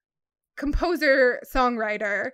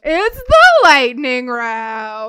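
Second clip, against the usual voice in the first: loud, slow radio host voice; shock jock radio host; 12 dB louder, much slower than usual. Pretend. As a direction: boom it out like a DJ. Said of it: "[name], get your shock jock voice on".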